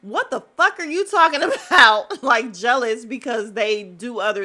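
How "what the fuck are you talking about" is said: loud, high-pitched mock outrage; incredulous retort. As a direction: throw back a loud, incredulous question, half laughing. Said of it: "her what was like".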